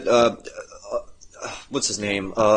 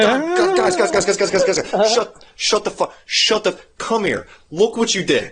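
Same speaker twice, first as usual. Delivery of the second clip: frantic god; 8 semitones higher than usual. Retold or imitated. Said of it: "he's just like".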